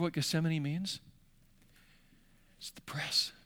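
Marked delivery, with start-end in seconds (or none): 2.6-3.3 breathy, hushed reveal; whisper. Real, not acted. Preacher to the congregation, a hushed whispered reveal. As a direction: lean in and whisper it.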